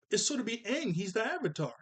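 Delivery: usual speaking voice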